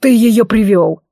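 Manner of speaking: slow, growly bark; barked accusation. A narrator's reading. Werewolf accuses the elf, barking. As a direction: throw the blame at him slowly. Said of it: "рявкнул оборотень".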